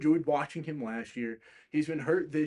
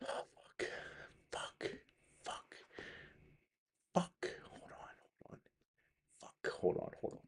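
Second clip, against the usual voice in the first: breathy under-the-breath swearing; whispered cursing; 9 semitones lower, 15 dB quieter than usual. Real, not acted. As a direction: hiss the curses to yourself so nobody hears. Muttering curses with mic trouble.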